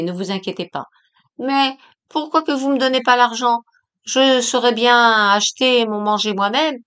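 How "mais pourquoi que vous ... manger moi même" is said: raised, pleading crone voice; whining old woman. A narrator's reading. Old woman demands the cash from Jacqueline, whining crone voice. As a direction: press her for the money like a grasping old beggar.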